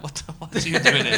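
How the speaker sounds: mock baffled. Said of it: "they were a little bit like".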